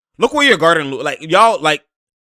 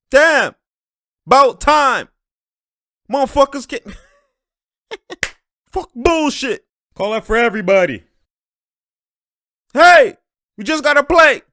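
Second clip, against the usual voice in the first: loud, high-pitched heated yelling; heated outburst; 9 semitones higher, 7 dB louder than usual. Real, not acted. Fan protesting bullshit calls, heated outburst. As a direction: holler it out, fed up with the refs. Reacting to disputed foul calls.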